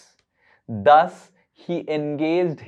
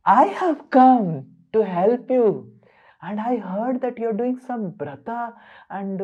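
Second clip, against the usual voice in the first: higher, coaxing offer of help; overly sweet son; 7 semitones higher than usual. Acted. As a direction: sweet-talk her into trusting you. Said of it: "then Indra said".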